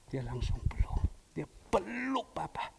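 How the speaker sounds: ordinary talk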